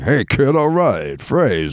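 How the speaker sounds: brisk, jerky comic greeting; choppy old-comedian patter; Rodney impression. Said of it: "it was kinda like".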